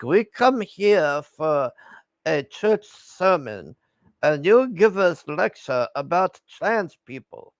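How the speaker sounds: slow, deliberate imitation of an affronted woman; Melania. Commentator voicing Melania complaining about the sermon, slow indignation. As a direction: draw out each phrase in mock indignation. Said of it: "She's just like".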